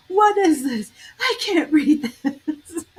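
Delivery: high, slow, laughing put-on complaint; mock flustered anchor. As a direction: whine it like a newsman who can't read the prompter. Mocking anchor's teleprompter meltdown.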